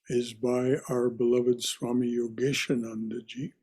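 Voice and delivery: ordinary talk, nothing marked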